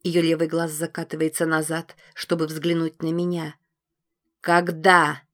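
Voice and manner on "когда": menacing repeat. A narrator's reading. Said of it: "повторяю я и слегка придавливаю горло".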